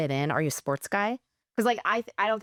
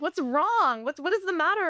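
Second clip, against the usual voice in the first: worried partner; 10 semitones higher than usual. Retold or imitated. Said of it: "Maria's like".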